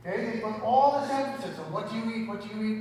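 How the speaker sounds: ordinary delivery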